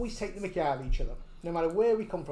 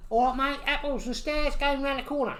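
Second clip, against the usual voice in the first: higher Cockney patter; Cockney accent; 6 semitones higher than usual. Shown as a demonstration. Asked for Cockney, bluffs rhyming slang, Cockney accent. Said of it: "What about Cockney?".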